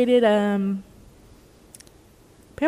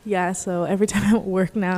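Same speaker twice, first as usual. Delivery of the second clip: ordinary talk, nothing marked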